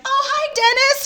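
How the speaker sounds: high, bright falsetto hello; overly sweet high-pitched hello; sweet greeting. Retold or imitated. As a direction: greet him in a high sugary voice.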